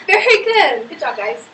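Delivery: laughing through praise. Real, not acted. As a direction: chuckle as you praise.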